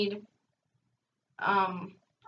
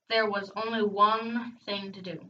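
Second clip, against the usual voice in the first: usual speaking voice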